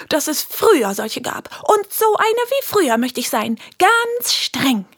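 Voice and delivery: high, strict little teacher. Acted. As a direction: sound proud and very strict. Girl in strict teacher role.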